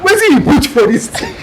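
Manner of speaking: high, laughing confusion; high amused bafflement; laughing disbelief. Retold or imitated. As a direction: laugh while asking. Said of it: "I'm like".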